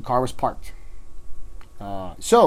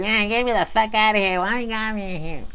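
high, whining put-on voice; drunk woman protesting; 8 semitones higher than usual. Arrested drunk woman in the back seat, whining protest.

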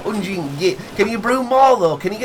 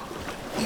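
shocked gasp; 14 dB quieter than usual. Acted. Shocked at beer being gone.

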